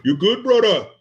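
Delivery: imitating a Samoan checking on a friend; Pacific Islander accent. Checking on the knocked-out guy, Pacific Islander accent. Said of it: "whenever I hear people talk like that with that accent".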